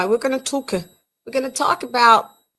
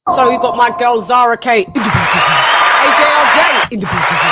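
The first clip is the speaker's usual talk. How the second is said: loud, fast hype shout-outs; 10 dB louder, faster than usual. Real, not acted. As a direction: call the names out like a hype man. Hype roll call shout-outs.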